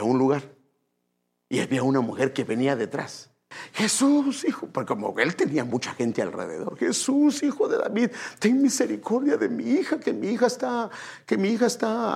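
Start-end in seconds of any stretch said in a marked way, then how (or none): 3.7-4.7 high-pitched calling out; acted cry for attention; imitated plea. Woman calling out after Jesus. 6.8-12.1 high, pleading call; acted desperate appeal; imitated begging. Woman begging Jesus for mercy.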